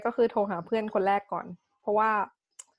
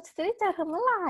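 put-on a lighter, higher lovestruck girl voice; dreamy girl in love; 11 semitones higher than usual. Wondering when she'll see her boyfriend, mildly high and dreamy. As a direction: ask it like a girl counting the days. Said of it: "แค่นี้ ผู้หญิงอะ".

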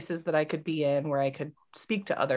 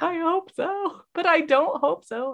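torn reply; 10 semitones higher than usual. Retold or imitated. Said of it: "And she's like".

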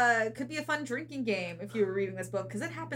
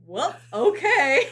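awkward laughing okay; 4 semitones higher, 9 dB louder, slower than usual. Retold or imitated. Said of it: "Reed is like".

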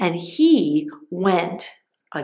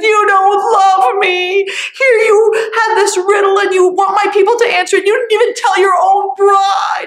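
crying bride; 12 semitones higher, 8 dB louder than usual. Acted. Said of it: "and she was crying".

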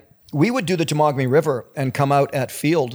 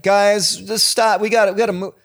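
urgent hurry-up; 6 semitones higher, 3 dB louder than usual. Retold or imitated. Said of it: "it's like".

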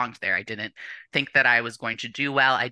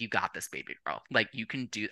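close to tears; 3 semitones lower, 7 dB quieter than usual. Real, not acted. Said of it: "oh, I'm gonna cry".

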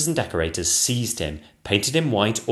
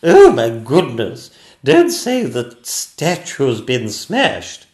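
very loud, slow, drawn-out regal cry; a queen crying out in high-pitched alarm; dismayed Queen's cry; 5 semitones higher, 11 dB louder, much slower than usual. Acted. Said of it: "cried the Queen".

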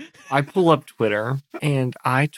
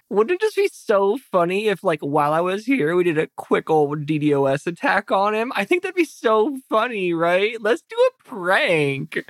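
higher, lilting faux-innocent post; mischievous influencer; 6 semitones higher than usual. Influencer urges followers to prank a man, mischievous. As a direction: pitch a prank with mock innocence, stretching the last words. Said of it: "I'm gonna say".

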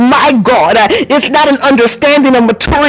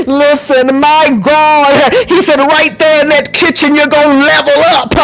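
raised, driving preacher's cadence; prophetic preaching; 5 semitones higher than usual. Real, not acted. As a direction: preach it like a prophecy over the church. Prophetic preaching over music.

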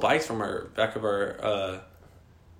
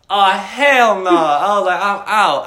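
loud, high hammed-up alarm, laughter creeping in; panicked outburst; 8 semitones higher, 10 dB louder, slower than usual. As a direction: retell the panic at full volume. Retelling his panicked flight from the room.

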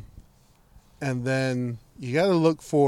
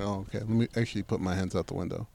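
quick, soft, meek backing-down; suddenly humble, rushing to obey; sheepish compliance; 5 semitones lower, 12 dB quieter, faster than usual. Retold or imitated. Speaker retelling how he gave in to the cop, soft and sheepish.

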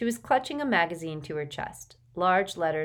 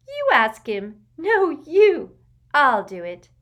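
preteen giggling secret; 9 semitones higher, 11 dB louder, slower than usual. A narrator's reading. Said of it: "whispering and giggling".

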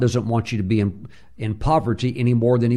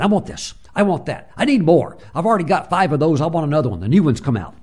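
high, loud, fast greedy demands; greedy grabbing voice; 5 semitones higher, 4 dB louder, faster than usual. Retold or imitated. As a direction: act the grabby consumer wanting more. Voicing greedy consumer.

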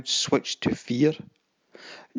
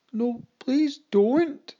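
high, slow, stretched-out plea; terrified yell; 9 semitones higher, much slower than usual. Acted. Boy pleads with the captain, a high slow terrified plea. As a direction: beg in real terror. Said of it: "yelled the boy, sounding genuinely terrified".

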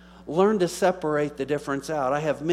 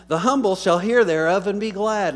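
loud, high-pitched verse proclamation; scripture declamation; 6 semitones higher, 8 dB louder than usual. Real, not acted. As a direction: read it out boldly to the whole church. Reading scripture aloud to congregation.

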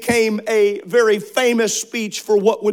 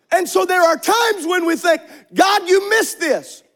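preaching at full force; 7 semitones higher than usual. Acted. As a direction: lift the church with every line.

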